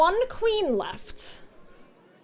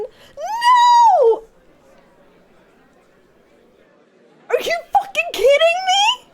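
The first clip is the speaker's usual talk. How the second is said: much higher, much louder crying yell; anguished scream; 10 dB louder, slower than usual. Real, not acted. Player screams in despair at another bad draw.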